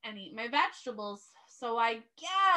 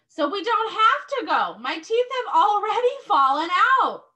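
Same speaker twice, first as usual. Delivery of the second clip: put-on child's voice; 7 semitones higher, 8 dB louder than usual. Teacher voicing Lily.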